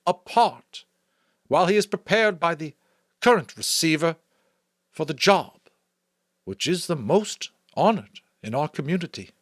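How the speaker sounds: measured public explanation from the stage; Chief Elder explaining. A narrator's reading. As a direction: ease the room's tension while explaining the rules.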